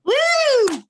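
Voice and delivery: high, loud drawn-out "woo"; excited cheer. Real, not acted. Guest welcomed onto the podcast, excited cheer. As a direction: whoop it out long and high.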